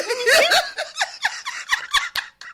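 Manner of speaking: loud, high burst of laughing; big laughter. Real, not acted. Laughs at the retold admission.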